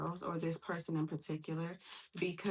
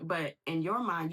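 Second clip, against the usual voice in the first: ordinary delivery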